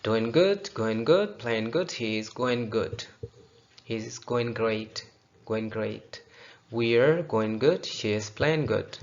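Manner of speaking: very slow, t silent in great; slow demo. Shown as a demonstration. Teacher slowly models sentences for students. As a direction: stretch each word out for the class. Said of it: "make silence".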